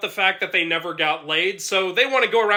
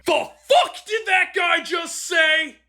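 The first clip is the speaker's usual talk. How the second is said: angry shout; 10 semitones higher, slower than usual. Acted. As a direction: bellow it in disbelief. Angry outburst with music.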